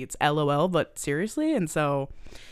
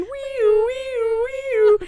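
high siren wail; siren noise; 5 dB louder, much slower than usual. Acted. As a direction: imitate an ambulance siren. Siren imitation.

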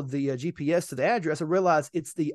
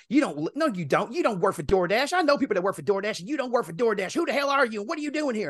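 higher mock-outraged insistence; retold indignant accusation; 5 semitones higher than usual. Resident disputes driver's DoorDash job, imitated indignant accusation. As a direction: imitate her disbelief, building higher. Woman disputes that he works there.

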